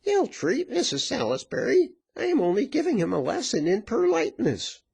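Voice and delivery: high-pitched mock-innocent bully; high, brash tavern woman; bullying virago. A narrator's reading. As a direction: boss him about in a high woman's voice.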